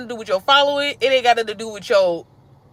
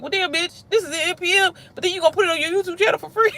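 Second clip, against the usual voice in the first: high, fast, sassy imitation of a member calling her out; mock a sassy viewer griping; sassy mock complaint; 9 semitones higher than usual. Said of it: "want nobody to be like".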